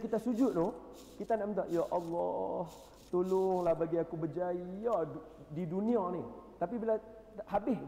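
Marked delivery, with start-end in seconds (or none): 1.7-6.3 lightly voiced pleading prayer, higher and drawn out; mock prayer plea. Preacher imitating a worshipper begging Allah for success. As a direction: plead like a man praying for worldly success.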